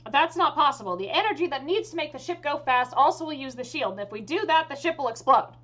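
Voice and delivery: high, insistent refusal; exasperated elf mage. Acted. Elf mage warns the ship will explode, exasperated.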